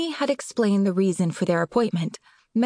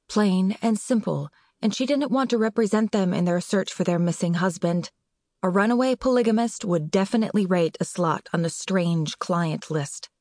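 ordinary delivery, nothing marked

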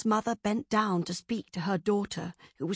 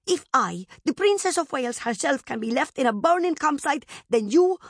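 put-on higher-pitched spiteful child; angry spite; 6 semitones higher, 4 dB louder, faster than usual. A narrator's reading. Abandoned princess to her servant, with angry spite. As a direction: scold haughtily in anger. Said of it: "rounded on her with sudden angry spite".

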